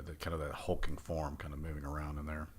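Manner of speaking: normal talking voice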